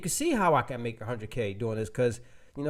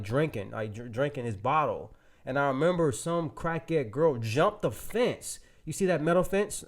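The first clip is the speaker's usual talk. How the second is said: normal talking voice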